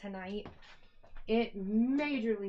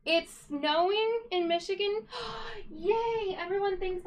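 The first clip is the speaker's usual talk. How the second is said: high, loud squeal of joy; delighted; 9 semitones higher, 5 dB louder than usual. Real, not acted. Streamer delighted that it's snowing in Michigan, squealing. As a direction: gasp then cheer.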